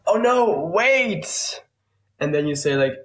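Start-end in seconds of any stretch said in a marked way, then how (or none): 0.1-1.6 high squeaky "Oh no, wait!"; a jokey panic, way up high; mock panicked English.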